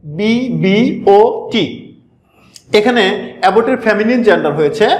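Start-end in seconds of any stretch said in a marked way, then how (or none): 0.0-1.9 letters spelled out one by one, very slowly; slow letter-by-letter dictation; slow spelling. Shown as a demonstration. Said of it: "একটু খেয়াল করো".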